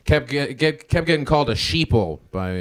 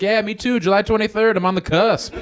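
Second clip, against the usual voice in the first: high mock voice; 10 semitones higher than usual.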